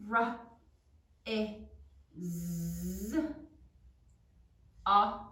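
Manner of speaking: ordinary delivery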